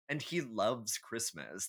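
ordinary talk, nothing marked

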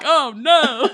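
high, dismayed mock of Larry; Larry's oh no. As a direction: pull away with a high oh no. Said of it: "Just the way he said it".

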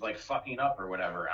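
normal talking voice

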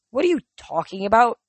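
growled. A narrator's reading. Thalia confronts the Manticore about her supposed victory, growling. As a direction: challenge him with a touch of anger. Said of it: "Thalia growled".

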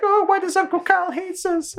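put-on high-pitched little kid voice; whiny child impression. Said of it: "Cartman's kid's like".

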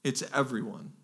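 soft, falling "everyone"; quiet restatement. Real, not acted. Preacher assuring the congregation it covers all, quiet "everyone". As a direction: drop "everyone" down softly, as settled fact.

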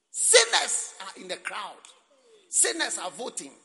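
loud, slow stress on Sinners; emphatic preaching. Real, not acted. Preacher warning the congregation, loud and slow on Sinners. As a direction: lean hard on Sinners.

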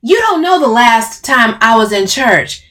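loud, high angry snap; snapping. A narrator's reading. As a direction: snap back defensively. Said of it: "Camille snaps".

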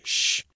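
sharp hushing hiss; angry shush. A narrator's reading. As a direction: cut your wife off with a shush. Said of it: "glanced at his wife angrily".

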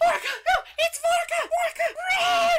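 very high, panicked squeal; terrified goblin shouting. Acted. Poog warns the others about Vorka, terrified shrieking. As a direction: shriek a warning in a tiny panicked voice. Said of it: "He looks absolutely terrified".

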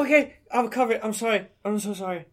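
high, small pleading voice; contrite apology. Acted. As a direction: apologize like a scolded child. Apologizes after being scolded.

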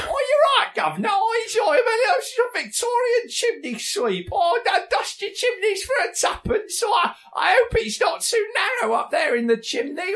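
put-on very high cockney chimney sweep child; squeaky Victorian urchin in cockney; old London cockney. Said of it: "voice of a child ... Victorian chimney sweeper".

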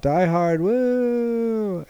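high-pitched mock yell, no real volume; retold yell. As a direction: imitate a passing fan's whoop, held back. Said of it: "yells out".

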